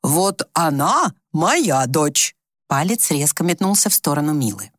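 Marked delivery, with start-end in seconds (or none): 0.0-2.3 very slow "Вот она", heavy on "моя"; emphatic pointing-out. A narrator's reading. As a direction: set "моя дочь" apart with firm emphasis. Mother points out the real daughter.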